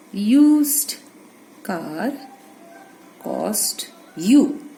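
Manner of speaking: each word alone, with long pauses; word-by-word dictation. Shown as a demonstration. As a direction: dictate it slowly for the class. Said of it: "dictating word by word".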